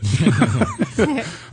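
high burst of laughter. Real, not acted. Laughing at his own mock panic.

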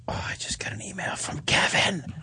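whispered aside. Real, not acted. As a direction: whisper it off-mic. Host aside off-mic.

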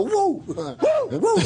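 high-pitched whooping "woo"; imitated wrestler's catchphrase whoop, leaping up high; Ric Flair. Wrestler telling how his partner vanished, mock high whoop. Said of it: "and then Flair, he's gone".

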